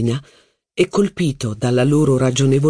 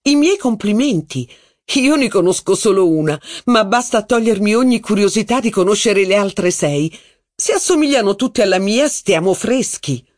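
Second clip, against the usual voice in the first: higher, lively society duchess; a duchess holding court, higher; haughty duchess; 6 semitones higher than usual. Acted. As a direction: gossip grandly about her cousin's wife. Duchess voiced in reading.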